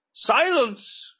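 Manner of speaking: roared at full force; thunderous angry roar. A narrator's reading. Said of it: "roared Talhages".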